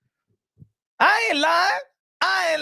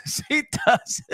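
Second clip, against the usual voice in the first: laughing. Real, not acted. Laughing insistence.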